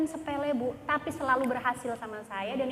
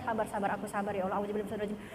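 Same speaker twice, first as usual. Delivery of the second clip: low, soft muttered prayer; acted self-calming; 5 semitones lower, 5 dB quieter, much faster than usual. Wife prays for patience with her husband, low mutter. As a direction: mutter yourself down from annoyance.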